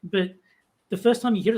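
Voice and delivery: ordinary talk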